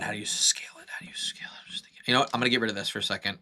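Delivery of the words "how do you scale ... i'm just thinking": thinking aloud in a whisper; real whispered thinking aloud. Real, not acted. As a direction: whisper it to yourself while puzzling. Whispering while thinking hard.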